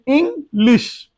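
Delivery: English slowly broken as "ing, lish"; split into syllables. Shown as a demonstration. Teacher breaking a word for learners, English split as "ing, lish". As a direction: say English in two separate parts, ing and lish. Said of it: "উচ্চারণ করতে গেলে আমাদেরকে একটু ভাঙতে হয়".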